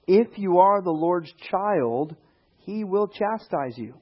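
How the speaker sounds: slow, deliberate reading of the verse; slow scripture reading. Shown as a demonstration. To the congregation, quoting Hebrews in a slow, solemn read. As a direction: let each word of scripture land slowly. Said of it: "what does the book of Hebrews say".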